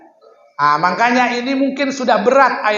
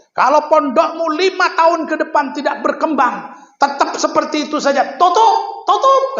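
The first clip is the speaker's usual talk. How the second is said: angry scolding; 8 semitones higher than usual. Retold or imitated. The Gontor kiai scolding the new founder, building to a shout. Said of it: "pokoknya beliau marah".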